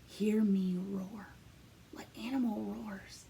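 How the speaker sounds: slow teasing whisper. Acted. Teacher teasing the class before they roar, hushed whisper. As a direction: hush them before the big roar.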